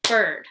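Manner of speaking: bird said loud with a single clap; one loud beat on bird; teacher clapping a word into one part; clapped one part. Shown as a demonstration. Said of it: "So when I say bird".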